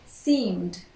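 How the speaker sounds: "seemed" as one syllable ending M-D; slow, careful "seemed", M straight into D; silent E. Shown as a demonstration. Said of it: "we do not pronounce the E".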